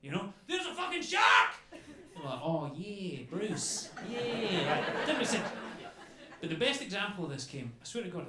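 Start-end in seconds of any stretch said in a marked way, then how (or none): 0.5-1.5 high, loud panicked shout; freaking out. Acted. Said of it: "we were freaking out about stuff". 2.4-5.1 unbothered Australian, drawn-out vowels; relaxed Australian accent. Retold or imitated. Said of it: "they sound so relaxed all the time".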